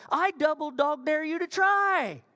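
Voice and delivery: high, loud, drawn-out dare; really emphatic. Acted. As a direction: throw it down as an emphatic challenge. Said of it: "it's really emphatic".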